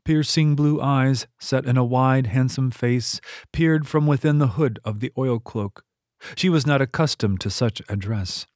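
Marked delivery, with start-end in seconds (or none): none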